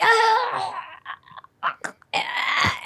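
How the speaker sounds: high rasping cough then breathy hack; mock coughing fit. Roommate coughing into a paper bag, mock cough. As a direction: fake an old woman hacking. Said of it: "coughing fit where she'd be like".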